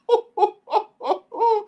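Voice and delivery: high disbelieving laugh; incredulous laugh. Real, not acted. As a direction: commentator laughing at Denver giving up. Laugh at Denver quitting.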